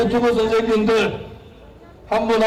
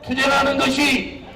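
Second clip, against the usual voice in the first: high, impassioned rally shouting; roaring rallying speech; 5 semitones higher than usual. Real, not acted. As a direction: preach it to the crowd at full force.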